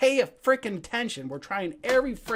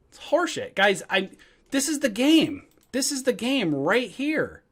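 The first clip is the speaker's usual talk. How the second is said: pissed off. Real, not acted. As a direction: hammer the point home, fed up. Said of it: "piss me off today with them".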